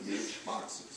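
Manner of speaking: words breaking into a quiet laugh; laughing through words. Real, not acted. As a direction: let the laugh take over the last words. Recalling silly shop boxes, laughing.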